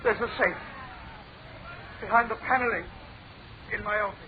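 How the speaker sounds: soft, broken admission, trailing down; defeated confession. Acted. Preston confesses to Blake where the safe is, defeated. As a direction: give up, spent.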